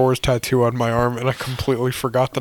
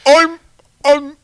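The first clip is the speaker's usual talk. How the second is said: high, slow, halting mock-Cockney; Michael Caine; 7 semitones higher, slower than usual. Actor listing his roles, hammed-up Cockney impression. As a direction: introduce yourself as a quavery Cockney actor. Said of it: "You gross old British man".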